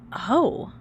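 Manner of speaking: ordinary delivery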